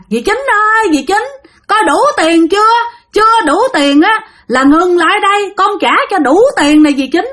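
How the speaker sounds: high, eerie call from the bank; high ghostly voice taunting Dì Chín; ghost calling after them. Retold or imitated. Ghost chases boat, calling.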